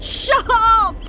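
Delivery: high, slow furious shout at the voices; desperate scream. Acted. Bria begging the voices in her head to stop, screaming desperately. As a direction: scream at them to stop, at the end of her rope.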